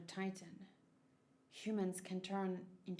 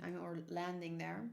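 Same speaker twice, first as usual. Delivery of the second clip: usual speaking voice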